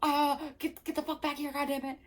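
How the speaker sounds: high, loud hammed-up yell; mock screaming. Mocking Jared calling Melanie back, hammed-up screaming. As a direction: parody a man screaming in someone's ear. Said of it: "right in her ear screaming".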